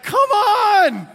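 very high, loud cry of frustration; exasperated shout. Retold or imitated. Pastor reliving his sister's spoiler text, shouting. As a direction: yell at the spoiled ending. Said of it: "And I'm like".

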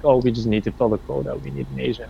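mimicking a casting director. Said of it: "they were like".

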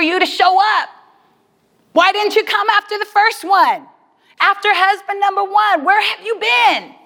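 upset prayer asking where God was; mad at God. Retold or imitated. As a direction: demand answers from the Lord. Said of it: "I'm thinking in that space".

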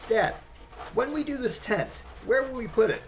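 put-on young son. Son asking dad at the bus stop, small boy voice. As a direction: ask like a curious small kid.

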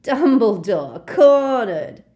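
gleeful Death Eater, loud; gloating. Acted. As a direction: crow over the trapped old man. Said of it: "gave a wheezy giggle".

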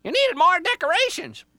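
very high, loud bird screech; squawking Jaybird. Acted.

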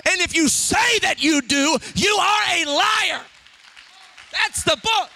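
loud, emphatic pulpit delivery; full-force preaching. Real, not acted. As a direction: hammer it home like scripture.

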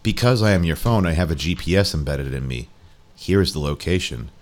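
calm, deliberate machine voice. Acted. The phone tells Jerry its findings, low and measured.

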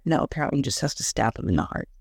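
normal talking voice

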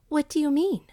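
high, loud startled question; disbelieving. A narrator's reading. Widow hearing a priest promise mercies, astonished. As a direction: ask as if you can't believe your ears. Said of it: "faltered Missus Wang, hardly believing her ears".

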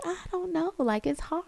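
put-on high, soft, whiny kid voice; whiny daughter. Daughter telling mom track is hard, high and whiny. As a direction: mumble the excuse like a sulky girl. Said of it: "she's just like".

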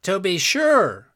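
loud, drawn-out royal agreement; exclaiming. A narrator's reading. King agreeing with his man's proposal, exclaiming. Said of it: "exclaimed the king".

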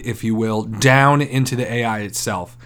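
normal talking voice

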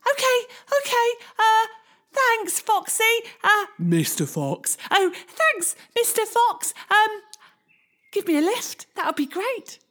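high nervous little voice; gingerbread man voice. Acted. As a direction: squeak it out, high and unsure.

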